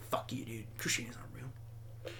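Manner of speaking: very soft, half-whispered jab; mock muttered heckling. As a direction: say it under your breath, low and hushed. Acting out a heckler's quiet insult.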